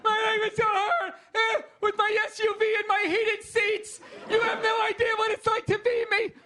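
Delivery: high, whiny mock complaint; whiny complaining voice.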